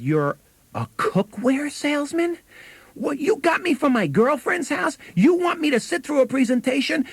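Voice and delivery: high-pitched mock indignation, slowing down; retold angry outburst. Salesman imitates the girl annoyed at him, in a high mock-angry voice. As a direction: play the annoyed sister caught off guard. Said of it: "she really got angry at me".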